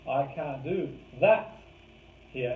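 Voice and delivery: slow, with that rising and lingered on; stress on that. Shown as a demonstration. Coach showing behaviour emphasis, stress on that. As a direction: lift and hold the word that. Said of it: "Emphasizes behaviour".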